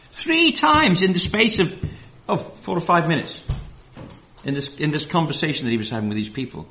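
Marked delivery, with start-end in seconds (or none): none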